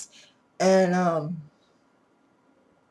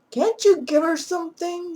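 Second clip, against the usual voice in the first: much higher, pleading voice of a desperate husband; 11 semitones higher, 6 dB louder than usual. Retold or imitated. Husband begging the doctor for something, high and pleading. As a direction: beg the doctor like a panicked husband.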